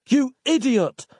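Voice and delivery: very high, exasperated outburst; high-pitched angry cry. A narrator's reading. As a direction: burst out at someone's stupidity. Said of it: "he cried".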